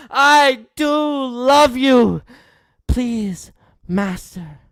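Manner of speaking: impassioned open-mic poem reading, swelling to shouts; spoken-word poet pleading, building to cries; poetry performance. Acted. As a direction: pour the poem out like a plea, rising and falling.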